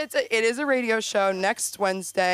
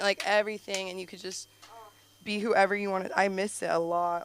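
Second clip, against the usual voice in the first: ordinary talk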